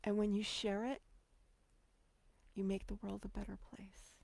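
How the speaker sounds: breathy near-whisper, moved; emotional whisper. Real, not acted. Closing thought to authors, whispered with emotion. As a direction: let it fall to a whisper. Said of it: "I'm getting emotional".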